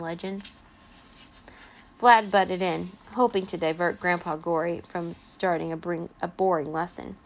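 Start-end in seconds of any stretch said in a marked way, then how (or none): none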